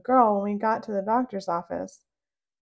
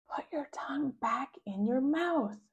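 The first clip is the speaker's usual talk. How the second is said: soft urgent whisper; mom whispering; 4 semitones higher, 5 dB quieter than usual. Acted. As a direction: hush the child without being heard. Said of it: "My mom whispers to me".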